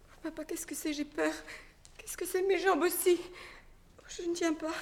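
high, scared and weakening; frightened. Acted. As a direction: she feels herself fail. Empoisonnée, elle a peur. (effrayée, à bout de forces)

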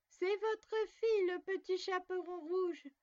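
high, slow, put-on little girl voice; wolf faking a girl's voice. A narrator's reading. Wolf at the door posing as the girl, high put-on voice. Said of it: "en contrefaisant sa voix".

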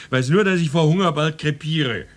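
high, loud hungry bellow; exasperated yell. Acted. Starving husband demanding dinner from wife, exasperated yell. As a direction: burst out, then yell through the house.

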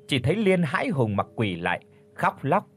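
usual speaking voice

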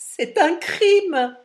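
put-on slow, loud outcry from the girl; young girl's shocked loud cry. A narrator's reading. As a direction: call out that it's a crime, high and drawn out. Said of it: "dit la jeune fille".

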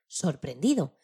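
ordinary delivery

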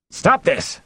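high-pitched command, moderately shouted; mayor's shout. A narrator's reading. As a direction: call the crowd to order. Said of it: "the mayor shouted".

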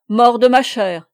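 juron lancé très fort; il éclate d'un rire bravache; juron crié. A narrator's reading. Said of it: "s'écria-t-il". (juron tonitruant du capitaine)

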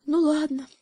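high, resigned sigh of a hare; sighing resignation. Acted. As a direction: give up with a sigh. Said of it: "вздохнул заяц".

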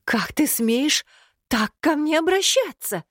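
high, outraged and slow at first; flaring up. Acted. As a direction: flare up in indignation. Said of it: "Снова взвилась Алирами".

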